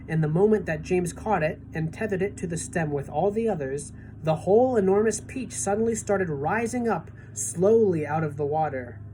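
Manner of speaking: ordinary delivery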